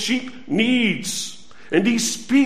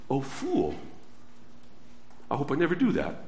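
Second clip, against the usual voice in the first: soft mock rebuke; 7 semitones lower, 12 dB quieter, faster than usual. Imagined pastor rebuking visitor.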